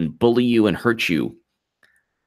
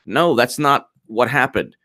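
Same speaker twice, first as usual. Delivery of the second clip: usual speaking voice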